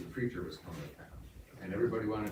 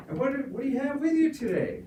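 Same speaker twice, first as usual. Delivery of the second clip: much higher, loud gushing preacher; hammed-up preacher; 6 dB louder than usual. Preacher welcoming the children at the tent, high and gushing. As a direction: sing it out like an over-sweet preacher, slowing at the end. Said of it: "preacher asks".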